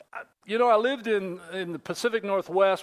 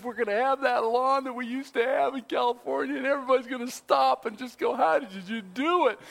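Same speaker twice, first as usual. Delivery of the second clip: higher-pitched, eager retold proclamation; excited announcement; 5 semitones higher than usual. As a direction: announce it to the household like great news. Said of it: "announce this great news".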